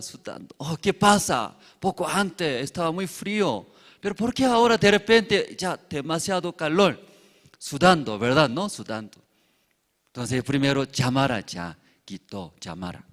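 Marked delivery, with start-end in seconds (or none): 0.6-7.0 puzzled passenger. Acted. Passenger suddenly hot and puzzled.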